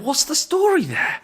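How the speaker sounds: high, bemused what's the story there; wondering aloud in a high mock voice; puzzled inner thought. Noticing a young woman driving an old Merc, high bemused. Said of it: "I just thought to myself".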